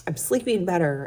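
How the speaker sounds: normal talking voice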